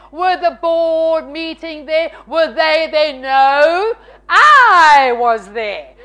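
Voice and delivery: indignant questions building to a loud outburst; mounting, hammed-up outrage; retold anger. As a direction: build up, then hit a long, loud I. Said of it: "because I am so mad".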